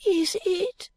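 put-on high-pitched, doubting boy; doubtful. A narrator's reading. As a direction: question it high, as an unconvinced boy. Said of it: "returned the boy doubtfully".

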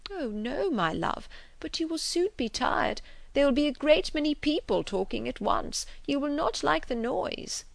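put-on fretful old father. A narrator's reading. Mr Woodhouse insisting she'll tire.

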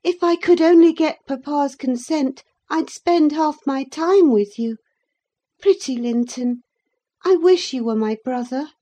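put-on high girl's voice, fondly wishing; affectionate young Catherine. A narrator's reading. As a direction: speak fondly to a cousin, pitched high.

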